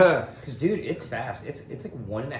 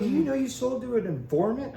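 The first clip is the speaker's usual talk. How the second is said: imitating the cop; 6 dB louder than usual. Cop voiced during raid.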